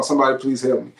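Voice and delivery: ordinary talk, nothing marked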